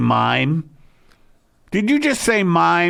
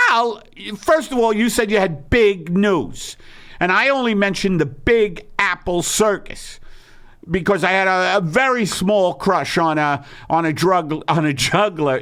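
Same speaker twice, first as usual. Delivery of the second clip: higher wife voice, defensive; Margaret voice; 3 semitones higher than usual. Acted. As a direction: explain yourself in the wife voice. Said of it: "two voices that Eddie Pepitone channels".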